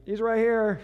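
called out high and loud; wife calling out, mildly loud; retold yell. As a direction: shout it across the yard. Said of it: "she yells out to me".

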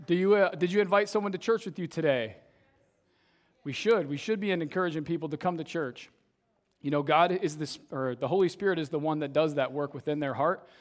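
usual speaking voice

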